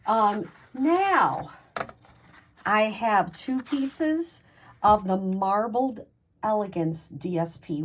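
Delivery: ordinary delivery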